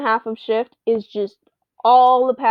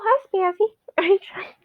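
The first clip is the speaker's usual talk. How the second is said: whispered question to the cat; 8 semitones higher than usual. Real, not acted. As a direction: whisper it, unvoiced.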